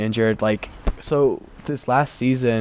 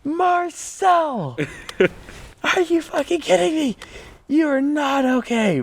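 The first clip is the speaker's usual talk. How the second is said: retold disbelief, much higher and drawn out; incredulous disbelief; slower than usual. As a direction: replay the shock at a dazed teammate, voice pitched way up. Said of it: "and I'm like".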